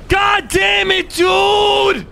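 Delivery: raging gamer. Real, not acted.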